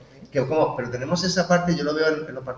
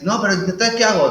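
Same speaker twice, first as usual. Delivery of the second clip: put-on whiny kid; 8 semitones higher, 7 dB louder than usual. Mimicking a helpless child.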